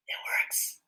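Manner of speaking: hushed, breath-only amazement; awed whisper. Pretend. Host seeing the homemade ring work, awed whisper. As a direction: whisper it in pretend wonder.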